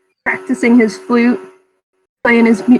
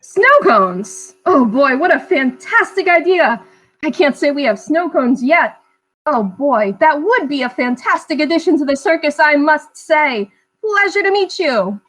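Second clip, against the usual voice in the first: showman ringmaster; 3 semitones higher than usual. Acted. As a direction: sell it like a circus ringmaster. Ringmaster replies excitedly.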